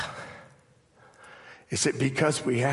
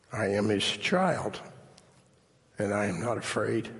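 poem reading. Acted. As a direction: read each line gently like a prayer. Reads song lyrics as poem.

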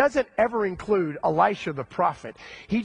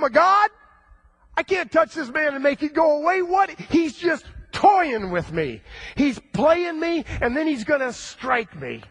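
high, loud, frantic panic; the king losing it, voice cracking up high in alarm; panicked wailing; 9 semitones higher, 3 dB louder than usual. Acted. Israel's king reading the letter, wailing in high loud panic. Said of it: "hear the king wailing and moaning".

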